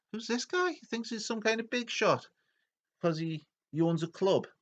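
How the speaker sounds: high-pitched scoffing voice; cocky mocking. Young raiders sizing up Ragnaros, high mocking scoff.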